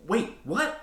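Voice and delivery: high startled yelp; a sudden squeak of alarm. A narrator's reading. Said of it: "I yelped".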